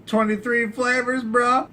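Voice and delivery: high, loud ad-style hype; loud mock-ad exclamation; hyped shout. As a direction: yell it like a soda commercial. Dr Pepper slogan shout.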